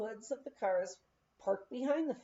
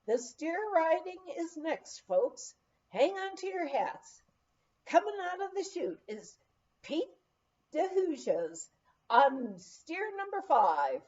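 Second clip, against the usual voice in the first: much higher, announcer-style calling out; rodeo announcer over loudspeaker; 7 semitones higher than usual. Retold or imitated. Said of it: "I heard over the loudspeaker".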